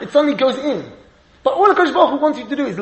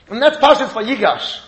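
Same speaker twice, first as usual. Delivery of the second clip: ordinary talk, nothing marked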